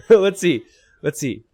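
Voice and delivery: high, loud laugh into the words. Real, not acted. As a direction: laugh while speaking. Sheep still unfound, caster laughs.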